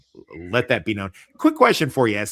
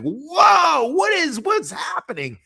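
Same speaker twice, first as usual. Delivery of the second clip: high, loud drawn-out "whoa"; mock astonishment; 6 dB louder, slower than usual. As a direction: imitate a stunned crowd crying out. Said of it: "they were like".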